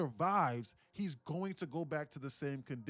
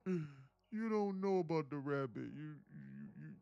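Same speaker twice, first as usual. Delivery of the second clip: mocking sister; 4 semitones higher, 8 dB louder than usual. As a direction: mimic her guilt-trip, stretching it out. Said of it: "She said".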